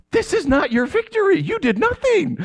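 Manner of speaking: high, mocking put-down voice; deflating friend. A non-fan mocking an excited sports fan, in a high teasing voice. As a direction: tease the fan for taking the credit. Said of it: "anyone who's not into sports is like".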